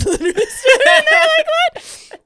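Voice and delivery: high, loud shriek of shock; hammed-up stunned squeal; mock disbelief. Acts out stunned group reaction.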